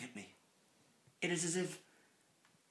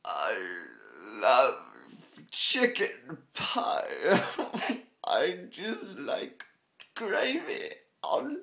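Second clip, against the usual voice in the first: loud, drawn-out, pitch leaping; hammed-up declaration; 9 dB louder, much slower than usual. Reader booming his love of chicken pie, slow and loud. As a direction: belt out a silly love of pie, slowly.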